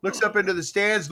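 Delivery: ordinary talk, nothing marked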